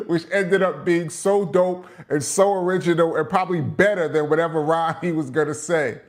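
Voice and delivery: ordinary delivery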